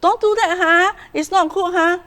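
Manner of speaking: high, whiny nagging; a parent nagging ineffectively, high and whiny; wrong tone. Shown as a demonstration. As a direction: nag in a thin high voice. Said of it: "Don't say".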